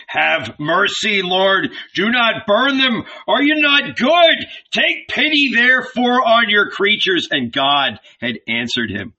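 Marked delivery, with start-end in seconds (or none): 0.1-7.3 rising desperate cry, louder and higher; Abraham begs the Lord, voice climbing to a shout; pleading shout. A narrator's reading. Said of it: "Abraham had shouted".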